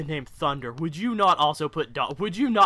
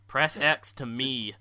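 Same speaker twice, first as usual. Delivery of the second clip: usual speaking voice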